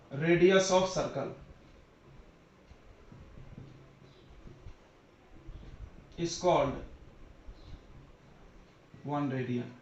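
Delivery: word-by-word dictation with long pauses; slow dictation. Real, not acted. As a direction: dictate in short pieces, waiting between them. Dictating a definition as it's written. (रुक-रुक कर लिखवाते हुए)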